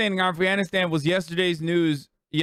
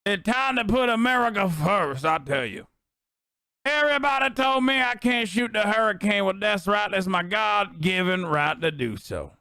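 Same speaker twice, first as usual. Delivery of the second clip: Clay Higgins impression; 2 semitones higher than usual. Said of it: "Clay Higgins".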